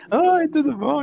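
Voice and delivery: high, loud imitation of a super-friendly hello; simpática exagerada. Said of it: "toda simpática". (imitando uma simpática empolgada)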